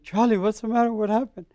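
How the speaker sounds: acted-out alarmed concern; retold worry. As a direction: urgently ask a friend what went wrong. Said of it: "I was worried about him".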